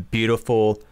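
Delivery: ordinary talk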